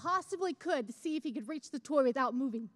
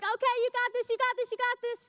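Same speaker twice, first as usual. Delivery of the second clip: cheerleading; 8 semitones higher than usual. Retold or imitated. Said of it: "We're, like, the biggest cheerleaders".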